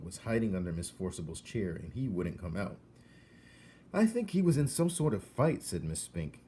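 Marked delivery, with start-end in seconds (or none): 3.9-5.6 put-on higher, louder worried old lady; fretful old lady. Miss Spink fretting about her dog, higher and worried. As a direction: fuss over it like a worried aunt.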